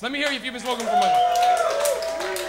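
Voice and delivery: loud, high, slow hype call; rally the crowd; hyping the crowd. Real, not acted. Comic hyping the audience to make noise, loud and high. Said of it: "make some noise".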